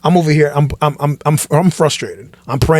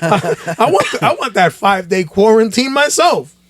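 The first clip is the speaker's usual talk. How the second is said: mock covid envy; 5 semitones higher than usual. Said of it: "And I'm like".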